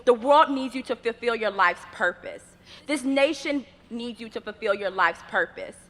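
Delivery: normal talking voice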